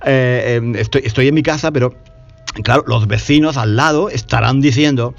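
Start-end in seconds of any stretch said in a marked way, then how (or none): none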